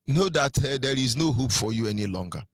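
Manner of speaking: ordinary delivery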